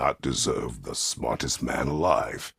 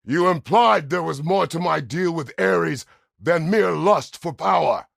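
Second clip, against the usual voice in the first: high, roaring accusation; angry shout; 10 dB louder than usual. Acted. Confronting someone over the deal with Ares, furious shout. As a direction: hurl it at them at the top of your voice.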